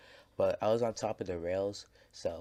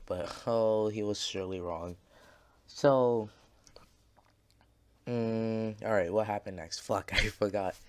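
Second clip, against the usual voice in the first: ordinary talk, nothing marked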